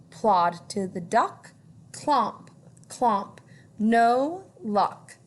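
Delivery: put-on lilting read-aloud for children; storytime reading. A narrator's reading. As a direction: read it like a picture book to kids. Reading rhyming book aloud.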